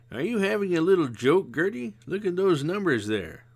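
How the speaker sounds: a hint of a weary old friend; mild tired sigh of a voice; Missus Zimmerman. A narrator's reading. Zimmerman challenges Gert.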